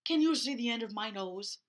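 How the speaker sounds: lightly voiced bear in the fog; a hint of a puzzled bear. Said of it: "asked the bear".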